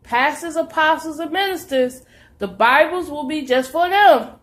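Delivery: high, loud preaching to the flock; fiery preaching. Acted. Preacher urging believers to study the word, loud and high. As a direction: preach it out full force to the saints.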